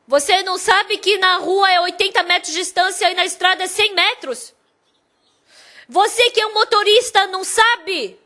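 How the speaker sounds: angry arguing. Retold or imitated. Man arguing with the bus driver over safe distance, angry. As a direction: lecture the driver heatedly, pitch up.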